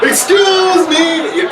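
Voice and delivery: slow, high drawn-out shout. Retold or imitated. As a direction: stretch the call out loud. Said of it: "He's getting louder".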